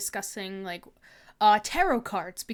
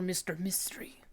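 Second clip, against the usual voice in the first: dramatic whisper; 5 semitones lower, 12 dB quieter, slower than usual. Acted. Streamer reveals the mystery man in a whisper. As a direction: whisper the name like a secret.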